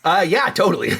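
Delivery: loud, high, mock-eager yes. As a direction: fake agreement, thrown out loud as a joke. Joke answer, then admits "No.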